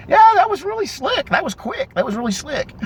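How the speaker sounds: high-pitched impressed mock reaction; imitated admiration. Both men admiring his move.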